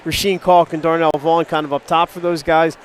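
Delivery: normal talking voice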